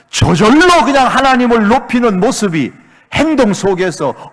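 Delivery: high, loud, driving sermon delivery; impassioned pulpit shout; full-force preaching. Acted. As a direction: preach it out at full volume, pressing each phrase.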